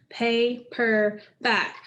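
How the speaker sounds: Paperback said slowly, pa, per, back apart; split into syllables. Shown as a demonstration. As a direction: count out Paperback slowly, beat by beat. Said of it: "are the three syllables".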